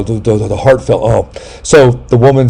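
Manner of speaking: normal talking voice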